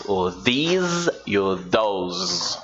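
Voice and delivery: these and those stretched very slowly, th sounds overdone; slow exaggerated teacher demo; exaggerated pronunciation. Shown as a demonstration. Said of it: "Estou exagerando na pronúncia justamente".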